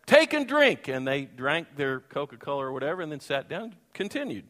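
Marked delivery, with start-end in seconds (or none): 0.1-0.9 ceremonial toast. Retold or imitated. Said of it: "And finally he said".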